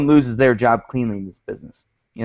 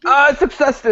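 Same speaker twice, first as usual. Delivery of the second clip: excited; 14 semitones higher, 4 dB louder than usual. Real, not acted. Joyful silly triumph.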